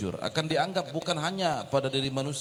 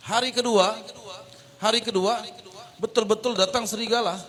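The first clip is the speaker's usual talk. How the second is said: louder, higher announcement of the second day; emphatic build-up; 6 semitones higher, 4 dB louder than usual. A narrator's reading. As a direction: call it out to grab attention. Storyteller builds suspense. (penekanan keras dan tinggi)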